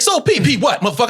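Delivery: high-pitched rowdy comeback; cocky challenge. Retold or imitated. Comedian reenacting his fired-up first improv, cocky challenge. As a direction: fire it out, spoiling for a confrontation.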